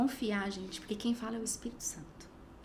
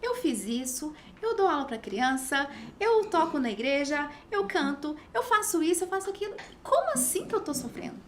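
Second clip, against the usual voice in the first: rising higher and louder into indignant disbelief; indignant complaint; 6 semitones higher, 5 dB louder than usual. Retold or imitated. Woman retelling her angry protest to God, indignant. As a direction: list good deeds with mounting protest.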